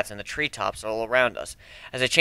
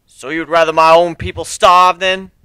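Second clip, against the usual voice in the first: louder bitter retort; spat; 5 semitones higher, 10 dB louder than usual. A narrator's reading. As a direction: spit it back at him. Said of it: "he spat".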